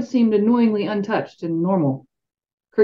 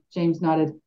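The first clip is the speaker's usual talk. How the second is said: ordinary delivery, nothing marked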